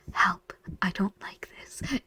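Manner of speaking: hushed whisper, stress on don't; whispered plea. Retold or imitated. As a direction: breathe it like a scared secret. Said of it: "looks you in the face and goes".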